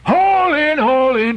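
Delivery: high, loud call to the crew; shouted order. Acted. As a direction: bellow it across the deck. Ahab calls crew to haul.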